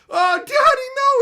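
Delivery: put-on very high, loud, slowed child's wail; child's shriek. Child diving in front of the blow, shrieking. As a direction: melodramatic kid shrieking for his dad.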